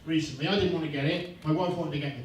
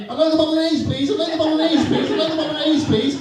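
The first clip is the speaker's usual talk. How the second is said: higher, louder repetitive whine; nagging diner; 10 semitones higher, 6 dB louder, faster than usual. Retold or imitated. Comic as a diner repeating his order, loud high whine. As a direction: keep whining the order like the cat. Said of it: "me sitting in a restaurant ... going".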